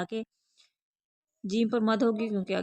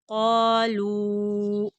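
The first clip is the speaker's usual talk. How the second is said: क़ालू slow with a heavy क़ा and long लू; full and drawn out; 6 dB louder, much slower than usual. Shown as a demonstration. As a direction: hold both vowels of क़ालू, full-mouthed q. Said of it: "खींच कर पढ़ेंगे". (क़ालू, पुर और खींच कर)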